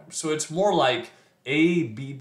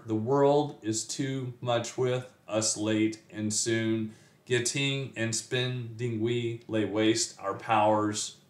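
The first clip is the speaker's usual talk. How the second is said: slow, measured da-DUM beat on "The world is too much with us"; scanned iambic meter. Shown as a demonstration. Teacher demonstrating iambic pentameter, slow scanned meter. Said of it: "it's iambic pentameter".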